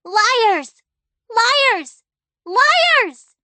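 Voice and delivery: put-on girl screaming at her parents, escalating; building shout. A narrator's reading. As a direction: scream it, climbing in pitch. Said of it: "Clara shouted".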